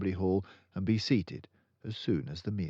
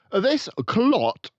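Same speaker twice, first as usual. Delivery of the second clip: loud, much higher boom; 13 dB louder than usual. Acted. Headmistress denouncing the boy, booming. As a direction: boom it to the whole school. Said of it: "boomed the headmistress".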